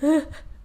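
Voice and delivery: a sudden high gasp of shock; startled intake of breath; sharp high gasp. Retold or imitated. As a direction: gasp as if someone spoke behind you. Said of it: "I was like".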